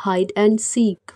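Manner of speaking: hide and seek stretched out word by word, very slowly; very slow repeat. Shown as a demonstration. Teacher repeating hide and seek for Hindi learners, very slow. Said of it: "लुका छुपी".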